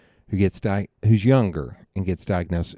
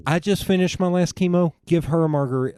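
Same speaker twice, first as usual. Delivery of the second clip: higher, louder mock-indignant mom; raised, insistent quoted plea; mom's insistent demand; 9 semitones higher, 4 dB louder than usual. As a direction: imitate a mother demanding her due. Acting out mom demanding a margarita.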